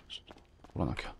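fast whispered mutter to himself; whispering. Real, not acted. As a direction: mutter it under your breath, quick. Muttering to himself mid-task.

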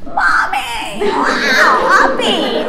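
put-on high, excited little-kid chatter; squeaky childlike exclamations; toddler voice. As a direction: imitate a toddler calling out to mommy. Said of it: "they're looking at you like this".

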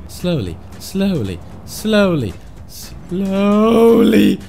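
repeated coaxing slowly; soothing slowly. Real, not acted. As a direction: coax the truck gently. Easing the truck along.

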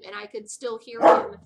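loud exclamation of disbelief; emphatic loud. Real, not acted. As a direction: push it out loud. Emphasizing how loud neighbor was.

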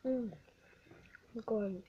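talking while chewing food; muffled by food in mouth; mouth full, chewing. Real, not acted. Seen 0: Eats chicken around the words; no Takis seen; jaw appears chewing.

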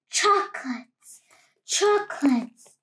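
cave echo. Acted. The cave echoing back to the greedy rat, fading echo. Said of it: "the voice from the cave replied".

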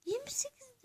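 tense whisper at the reveal. Real, not acted. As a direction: breathe it out, barely voiced.

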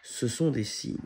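usual speaking voice

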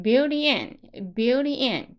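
"built in" said as one joined word; linked words. Shown as a demonstration. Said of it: "和后面的in呢连起来". (“built in”连读成一个词)

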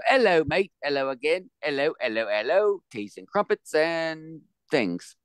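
hammed-up high British greeting, chirpy and cartoonish; mock British accent. American host greets a British listener in mock British accent. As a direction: play a bad British toff saying hello over and over. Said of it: "here's a proper accent for Carl".

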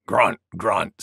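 low boar grunts spoken as words; grunting wild boar. A narrator's reading. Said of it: "said Wild Boar".

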